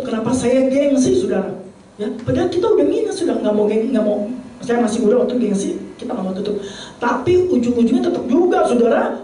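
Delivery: ordinary talk, nothing marked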